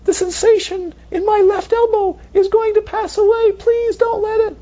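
high mock crying plea; whiny elbow sensation. Teacher voices an elbow sensation, high mock wail. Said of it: "hear a voice going".

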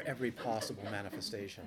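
ordinary talk

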